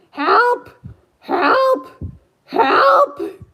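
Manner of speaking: very high, loud squawking help; imitating a bird's scream for help; parrot screaming. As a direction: shriek it like a panicked parrot. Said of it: "he'll sit there and scream".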